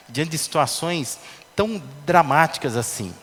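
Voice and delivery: ordinary talk, nothing marked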